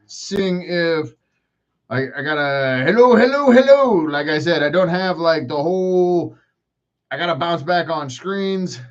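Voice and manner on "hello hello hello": loud, high hellos; raised, high-pitched hello; calling out. Real, not acted. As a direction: call out hellos, pitched up and louder. Calling out to the stream.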